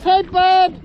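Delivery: calling out. Real, not acted. Calling to whale.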